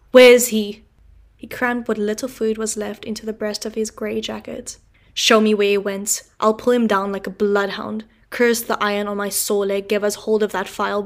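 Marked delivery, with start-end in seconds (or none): 0.1-0.8 loud fierce demand; urgent demand. Acted. Convict demanding where the other man went, loud. 5.1-11.0 loud fierce growling vow. Acted. Convict vowing to hunt the other, fierce and loud.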